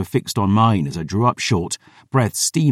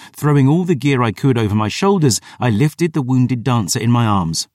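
ordinary delivery, nothing marked

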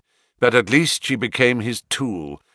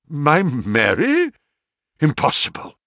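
shocked disbelief; 4 semitones higher, 3 dB louder than usual. Acted. Banker hearing his niece fled, shocked disbelief. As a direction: refuse to take it in.

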